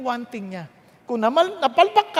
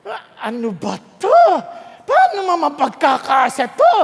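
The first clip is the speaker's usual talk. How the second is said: louder, rising exasperated complaint; exasperated outcry; 2 semitones higher, 6 dB louder than usual. Retold or imitated. Preacher mimics an employee over his payslip, louder mock outcry. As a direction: cry out in mock despair at the tiny pay.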